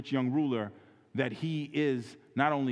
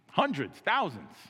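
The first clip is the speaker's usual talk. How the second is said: boldly boasting; 8 semitones higher, slower than usual. Retold or imitated. Boldly voicing the liar.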